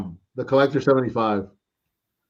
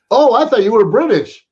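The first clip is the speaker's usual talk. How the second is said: higher, louder startled realization; mock surprise; 8 semitones higher, 5 dB louder than usual. Soldier realizing his mistake about a comrade, imitated surprise. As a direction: blurt it out, pitched way up.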